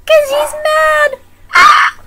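very high, loud, drawn-out yell; manic shriek. Acted. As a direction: shriek it slowly like a mad person. Manic answer about Mad Hatter.

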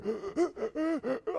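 rapid acted gasping for air; short, repeated breathless gulps; winded gasps. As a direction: gasp as if the wind's knocked out. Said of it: "I can't even breathe".

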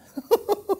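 high, sardonic cackle; acted high-pitched endless laugh; hyena laugh. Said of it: "un rire de hyène".

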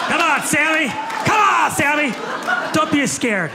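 high, loud goading call; mock coaxing shout. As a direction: holler at a shy audience member to speak up. Coaxing an audience member by shouting.

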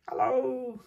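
a high little doll greeting; high toy hello. Pretend. Cody figure greets the viewers in a high voice.

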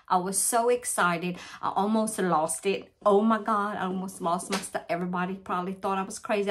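ordinary talk

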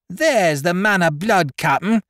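high, piercing cry of accusation; shrill it out to denounce him; high-pitched wail to the captain. Acted. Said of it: "he shrilled forth".

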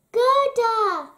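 high, loud cry of her name; shout. A narrator's reading. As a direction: shout it out in joyful recognition. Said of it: "he shouted".

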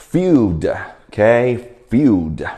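"field" said slowly on a falling pitch; pronunciation demo. Shown as a demonstration. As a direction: model "field" carefully for a learner. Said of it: "How to pronounce field?".

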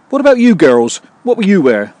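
high, loud put-on questioning voice; eager candidate. Candidate asking the girls what they'll wear, high eager voice.

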